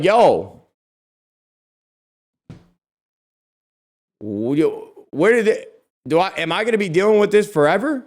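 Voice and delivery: high, loud, fed-up; exasperated text quote. Retold or imitated. Texting Hector about the hornets, high exasperated rant. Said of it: "I'm, like, pissed off".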